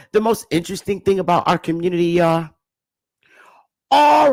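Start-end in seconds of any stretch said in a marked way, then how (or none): none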